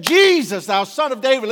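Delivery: loud, high cry over a crowd; calling out loud. Retold or imitated. Blind beggar crying out to Jesus over the crowd, loud and high. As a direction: call out to Jesus above the crowd. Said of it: "he called out unto Jesus Christ".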